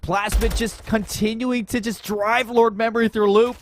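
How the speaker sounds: rising play-by-play call; esports caster. Acted. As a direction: call the action as it builds.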